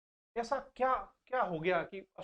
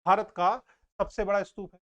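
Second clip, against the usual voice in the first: भारत का punched louder, then सबसे बड़ा स्तूप है; emphatic; 7 dB louder than usual. Shown as a demonstration. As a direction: hit Bharat का hard to drive the fact home. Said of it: "teacher stressing a fact". (Bharat का पर ज़ोर देकर बोलो)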